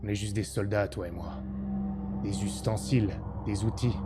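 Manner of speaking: low, quiet, weary deserter; low and quiet, tempting him away; gruff deserter soldier. Acted. Blond deserter soldier speaks low.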